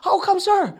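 startled, half laughing reply; surprised. Retold or imitated. Missionary caught off guard by the officer, surprised.

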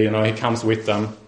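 ordinary talk

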